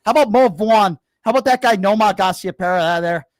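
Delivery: put-on loud, high-pitched Boston accent. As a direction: belt it out in a hammed-up Boston accent. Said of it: "that was my Boston accent".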